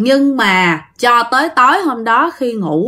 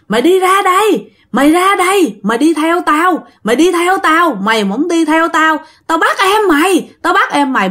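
high angry ghost yelling from the door; loud cursing ghost; 7 semitones higher than usual. Acted. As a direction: yell furiously, threatening. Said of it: "chửi la um sùm". (hồn ma chửi la um sùm)

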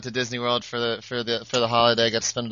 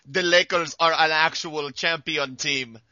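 slow, put-on Russian accent; 3 semitones higher, slower than usual. Podcast cohost mimicking the Nets' Russian owner, Russian accent. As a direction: imitate a Russian team owner. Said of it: "did he sound like this".

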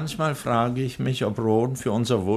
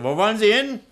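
sharp demand; 10 semitones higher, 5 dB louder, faster than usual. Acted. Doctor snaps at leaving servant.

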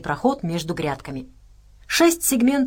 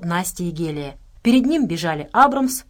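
plain reading voice

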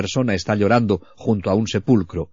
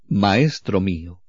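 reverent tenderness; 3 semitones lower, slower than usual. Retold or imitated. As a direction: say it in awe and love, slowly. María repite a Jesús. (reverente y lento, lleno de amor)

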